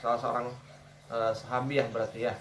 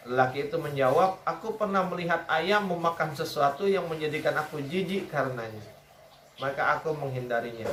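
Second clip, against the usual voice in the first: hadith reading; 4 semitones higher than usual. Acted. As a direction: read the story aloud deliberately. Reads hadith aloud.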